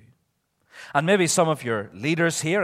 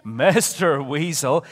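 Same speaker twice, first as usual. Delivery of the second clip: normal talking voice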